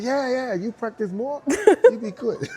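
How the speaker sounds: high, loud, encouraging; imitating a Japanese dancer. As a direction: pat him on the back with your voice. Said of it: "He said".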